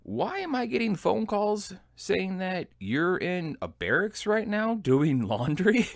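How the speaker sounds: mock exasperation from the platoon sergeant; annoyed sergeant. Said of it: "my platoon sergeant's like".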